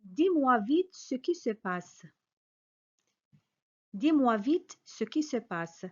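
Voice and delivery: ordinary talk, nothing marked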